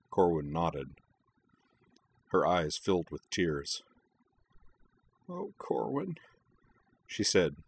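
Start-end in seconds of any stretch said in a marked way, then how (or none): 5.3-6.2 high, tearful voice cracking; voice breaking. Acted. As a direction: let the voice break with tears. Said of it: "her voice breaking".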